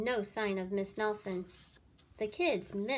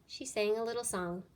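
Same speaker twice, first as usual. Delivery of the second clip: ordinary delivery, nothing marked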